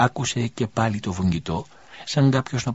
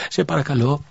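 higher, louder, urgent pleading man; a man begging the dying woman he loves to stay, higher and louder; pleading lover; 7 semitones higher, 5 dB louder than usual. A narrator's reading. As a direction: beg her to stay with you. Elias pleads with the dying widow.

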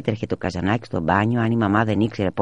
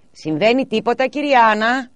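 high, louder calling neighbour; neighbour's voice; 14 semitones higher, 6 dB louder than usual. A narrator's reading. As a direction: call down from the balcony. (ο γείτονας ρωτά από τη βεράντα, δυνατά)

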